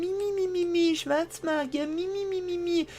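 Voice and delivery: mock the whining priest, squeaky and high; whiny mimicking voice. Mocks the fire mage's whining. (weinerliches Nachäffen in hoher Stimme)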